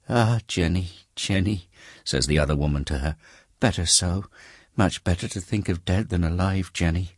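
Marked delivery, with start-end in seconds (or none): 0.1-1.7 tender. Acted. Woman consoling her friend. 3.6-7.1 higher poor woman, gently consoling. Acted. Woman comforting Jenny about her dead child, gentle.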